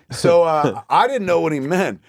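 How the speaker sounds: ordinary talk, nothing marked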